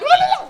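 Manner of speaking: very high, loud penguin honk; shrieked wordless cartoon babble; Pingu's babble. Imitating the penguin on screen, very high loud babble. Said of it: "when he'd be on screen like".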